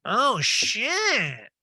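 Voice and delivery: mock perked-up exclamation, high pitched; Erica. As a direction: imitate a friend suddenly perking up. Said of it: "Erica's like".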